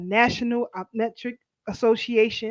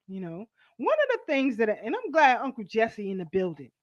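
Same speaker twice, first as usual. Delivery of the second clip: ordinary talk, nothing marked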